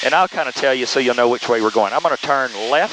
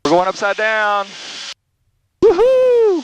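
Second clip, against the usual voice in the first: thrilled high whoop; whooping as the plane flips over; on a roller coaster; 7 semitones higher, slower than usual. Real, not acted. Rolling upside down in jet.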